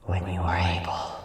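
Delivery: fading spirit whisper. Acted. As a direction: breathe the last words out, unvoiced.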